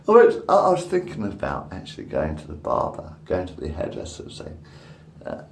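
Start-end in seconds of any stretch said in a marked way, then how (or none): none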